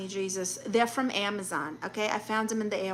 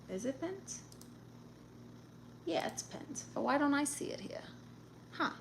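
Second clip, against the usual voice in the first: very soft, higher questioning; puzzled self-talk; 4 semitones higher, 13 dB quieter than usual. Real, not acted. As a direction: wonder quietly to yourself while checking. Puzzling to self over screen.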